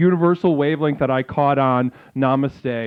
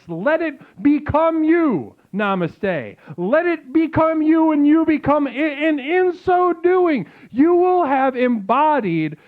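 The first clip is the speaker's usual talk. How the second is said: slow, higher, grandly dramatic preaching; mock solemn sermon; 7 semitones higher, slower than usual. Streamer preaching mock doom to chat, slow and grand. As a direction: drag out the doom like a prophet.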